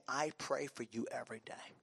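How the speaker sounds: soft, low, heartfelt quote; hushed sincerity. Retold or imitated. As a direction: say it quietly and earnestly. Repeats the tender quoted line.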